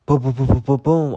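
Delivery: loud, punchy boom imitation; popping sounds. Pajamas catching fire, loud punchy booms. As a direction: imitate sparks popping like shots. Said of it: "it was like".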